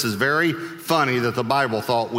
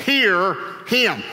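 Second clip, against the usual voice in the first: slow, higher, emphatic command; commanding voice from cloud; 7 semitones higher than usual. Acted. God adds two words from the cloud, commanding. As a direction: ring out each word like a decree. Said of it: "a voice came out of the cloud".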